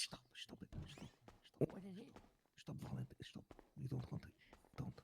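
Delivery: shushing the table so he can't hear; hushed pleading. Real, not acted. Player hushing the others at the table, whispered. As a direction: whisper urgently, almost no voice.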